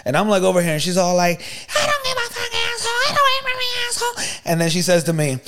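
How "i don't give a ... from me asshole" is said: very high mock screech; cussing woman imitation. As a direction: squeal it like an irate lady.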